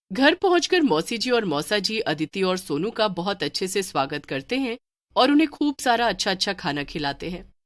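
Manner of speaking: plain reading voice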